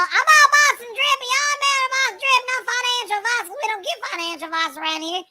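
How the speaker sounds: hyped-up sales voice. Retold or imitated. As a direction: hype them into buying drip. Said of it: "and it's all".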